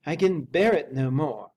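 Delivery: repeated despair. A narrator's reading. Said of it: "he repeated".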